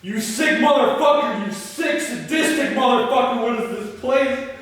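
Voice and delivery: high, loud enraged shouting; screaming rage at Jeff; furious yelling. Acted. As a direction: scream abuse at the captor. Ray confronts captor Jeff.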